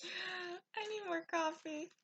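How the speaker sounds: worn out and stretching the words; weary. Real, not acted. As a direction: sigh it out, needing coffee. Weary, asking for coffee and nap.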